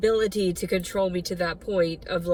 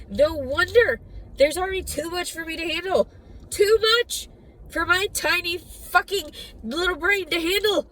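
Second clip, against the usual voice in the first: overwhelmed; 8 semitones higher than usual. Real, not acted. Venting to viewers about being overwhelmed, exasperated. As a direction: let it spill over, pitch climbing.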